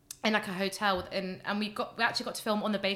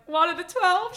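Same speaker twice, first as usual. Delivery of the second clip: high, loud rising question; re-enacted hopeful squeak of a question; retold hopeful question; 9 semitones higher, 7 dB louder, slower than usual. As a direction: ask it eagerly, pitched right up. Acting out her hopeful answer.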